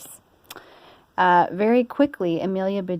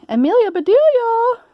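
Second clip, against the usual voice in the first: much higher, loud call across the house; 7 dB louder than usual. Acted. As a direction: call her name from another room. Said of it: "Missus Rogers was calling".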